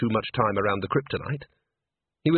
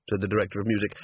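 usual speaking voice

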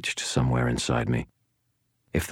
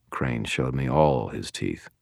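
ordinary delivery, nothing marked

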